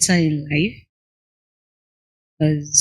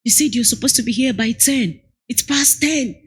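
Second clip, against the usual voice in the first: higher, mock-annoyed client complaint; imitating angry client; 7 semitones higher than usual. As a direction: whine like an impatient customer. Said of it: "when they start getting angry".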